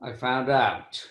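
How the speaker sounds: ordinary talk, nothing marked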